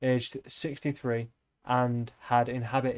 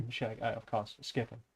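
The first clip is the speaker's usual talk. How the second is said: ordinary delivery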